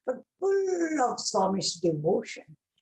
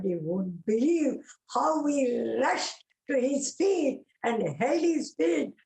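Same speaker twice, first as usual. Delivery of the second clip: normal talking voice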